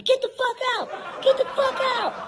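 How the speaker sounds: high crowd taunt; crowd jeering. Retold or imitated. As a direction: jeer him out of the pool, high. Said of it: "Everybody started telling me".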